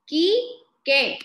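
"Quique" in two separate beats, KI-KE; loud teacher's "Quique", silent U. Shown as a demonstration. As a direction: sound "Quique" out as ki, ke, no U. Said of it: "tampoco digo la U".

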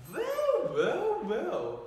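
hammed-up villain purring with glee; gloating villain. Mouse King gloats with his sword at the prince's neck.

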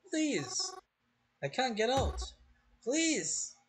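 high, frantic begging; desperate pleading. Acted. Trapped in a coffin, begging to be let out, desperate. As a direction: plead as if running out of air.

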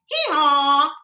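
loud two-note hee-haw like a donkey; donkey bray. Shown as a demonstration. Teacher showing kids the donkey's loud bray. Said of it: "I want you to hee-haw too".

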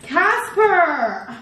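high, coaxing call to a dog; calling a pet. Real, not acted. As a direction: coax the dog to come. Calling the dogs.

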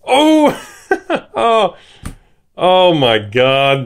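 high, loud gasp of oh breaking into a laugh; real surprise. Real, not acted. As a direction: caught off guard by a big hit. Hits a valuable card.

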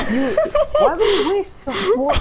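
loud, high exasperated shout; exasperated yell. Real, not acted. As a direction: yell in disbelief. Teammate wasting bullets, exasperated.

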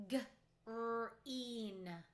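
g-r-ate said sound by sound, slowly, each sound apart; chopped into sounds. Shown as a demonstration. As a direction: break g-r-ate into its separate sounds for the class. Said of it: "I'm going to chop it out loud".

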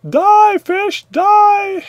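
high loud hammed-up "die"; mock villain shout. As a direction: ham it up like a cartoon villain. Clicking fish in game.

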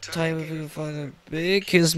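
ordinary delivery

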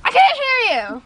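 high, loud holler; calling out. Pretend. Friend demands a reply to a question, hollering high. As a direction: shout it out for an answer. Calls out for an answer.

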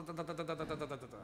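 rattled-off "ta-ta-ta", dying away; imitated machine-gun fire. Imitating a preacher spraying the congregation, machine-gun noise. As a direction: fire off a softening burst of syllables.

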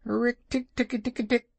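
high chattering rick tick cry; shrill-free high ticking war cry; mongoose war cry. A narrator's reading. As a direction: chatter it out like a fierce little mongoose. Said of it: "his long war cry of".

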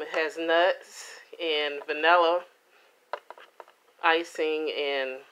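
ordinary talk, nothing marked

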